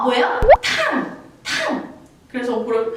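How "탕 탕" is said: high, yelped; ouch hot. Acted. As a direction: yelp it like a sudden burn. Acting burnt by oil. (데었을 때 높게 외치는 "탕")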